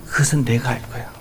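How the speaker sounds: soft, fading to a whisper; God speaking tenderly to a tired believer; tender reassurance. Acted. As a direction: comfort a weary child gently. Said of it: "그렇게 얘기해요".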